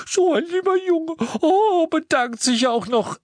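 high, crying gush; sobbing. A narrator's reading. Aunt Petunia weeping into Dudley's chest, high sobbing. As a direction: blubber the praise through tears. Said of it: "schluchzte sie".

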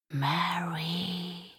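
breathy, toneless whisper of a name; ghostly whisper. Acted. Voice from the closet whispers the name eerily. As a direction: call her name like a spirit. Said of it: "gespenstisches Flüstern".

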